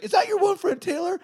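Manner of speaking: high, loud put-on enthusiasm; imitated keen mobster. Mobster inviting the friend along, imitated high enthusiasm.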